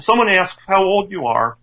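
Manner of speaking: ordinary talk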